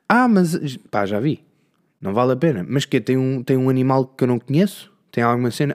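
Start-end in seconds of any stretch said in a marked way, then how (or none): 0.1-0.8 eager interruption. Retold or imitated. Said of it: "há sempre alguém que vê".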